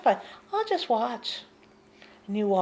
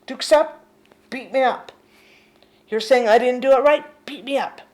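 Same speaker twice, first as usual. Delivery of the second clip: defiant challenge; 7 dB louder than usual. Retold or imitated. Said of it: "what I would say to the mind".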